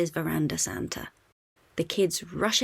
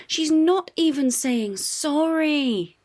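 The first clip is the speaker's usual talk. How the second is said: high, loud, slow protest; indignant cry; 9 semitones higher, 6 dB louder, slower than usual. A narrator's reading. Bluey complaining to Dad about Socks, indignant. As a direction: cry out that it's not fair. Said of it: "cries Bluey".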